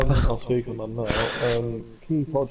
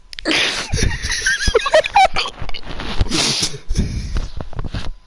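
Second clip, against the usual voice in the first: high laugh; 8 dB louder than usual. Real, not acted. Laughing after echoed shout.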